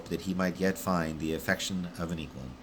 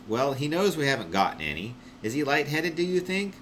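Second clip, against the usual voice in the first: higher, rising anxious question; worried Constant; 8 semitones higher than usual. Acted. Constant asking Wish about the climber, higher and worried. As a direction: fret that he's lightheaded.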